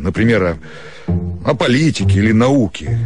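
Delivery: ordinary delivery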